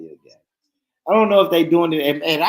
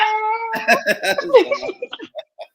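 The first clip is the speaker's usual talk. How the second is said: laughing hard. Real, not acted. Laughing at own impression.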